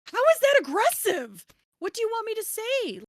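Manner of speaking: high, loud retort in mock outrage; flabbergasted. Author replaying her reply to an offended cover designer, flabbergasted. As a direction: retell it with pitch jumping up in disbelief. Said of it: "I was just flabbergasted".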